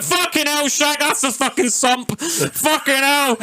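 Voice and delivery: high, alarmed mock yell from the back seat; panicked mate. Drunk mate in the back seat panicking about the car, high alarmed yelp. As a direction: squeal it like a drunk mate who just felt the sump go. Timmy yelling after hitting the bump.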